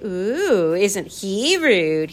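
usual speaking voice

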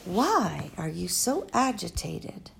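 lightly voiced elderly foreign woman, musing; a hint of an old, wise, rambling lady; Doctor Measham. Old doctor musing to Flora at the bedside, lightly voiced.

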